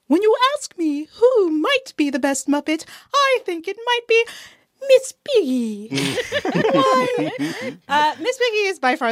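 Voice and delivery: high, hammed-up diva voice; theatrical diva reveal. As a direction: build grandly to the name like a preening star. Hammed-up reveal of favourite Muppet.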